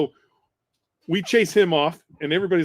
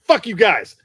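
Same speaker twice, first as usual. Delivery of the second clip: a loud, higher-pitched mock yell at the guys; angry outburst; 6 semitones higher, 8 dB louder, slower than usual. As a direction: toss it off as he storms away, fed up. Staff sergeant quits, storms off.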